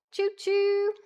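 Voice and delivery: high sing-song conductor call; chugging toy train voice. Pretend. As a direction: call all aboard like a cheery conductor. Streamer train hype call.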